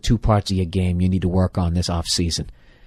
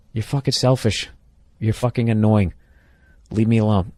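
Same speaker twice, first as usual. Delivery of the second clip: ordinary talk, nothing marked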